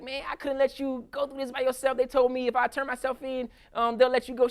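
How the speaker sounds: lightly voiced devoted husband; husband's plea. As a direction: plead gently, like a loyal husband. Husband explains turning himself in.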